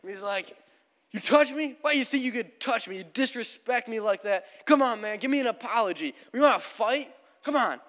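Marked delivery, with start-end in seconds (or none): 1.1-7.8 high, indignant challenge; angry hiker. Retold or imitated. Hiker confronting Raymond in a standoff, angry challenge. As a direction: imitate a man spoiling for a fight. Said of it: "the angry hiker's like".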